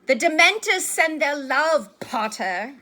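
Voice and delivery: high, loud, drawn-out jeer; taunting shout. A narrator's reading. Malfoy jeering at Harry leaving the hall, taunting shout. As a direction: call mockingly across a crowd. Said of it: "who shouted through the crowd".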